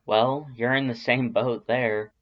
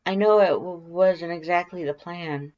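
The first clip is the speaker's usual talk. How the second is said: high, timid stammering voice; nervous stammer; 7 semitones higher, 4 dB louder than usual. Acted. Shy Alphys flustered about watching you sleep, high stammer. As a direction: stammer it out shyly, voice pitched well up.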